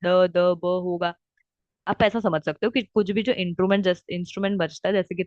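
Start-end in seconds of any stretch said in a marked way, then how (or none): 0.0-1.1 each voiced consonant spoken slowly and apart; careful teacher demo of voiced ड द ब; slow letter demo. Shown as a demonstration. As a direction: sound out ड, द, ब one at a time, slowly. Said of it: "जिसमें ग".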